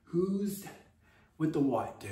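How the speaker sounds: hushed, breathless disbelief; mock whispered freak-out; whispered panic. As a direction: whisper it like you can't believe your luck. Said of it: "and I'm just like".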